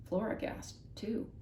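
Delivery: plain reading voice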